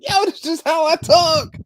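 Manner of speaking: very high, squeaky mock yell; exasperated shout. Repeating his defense to coworker, squeaky high shout. Repeats acted protest while laughing.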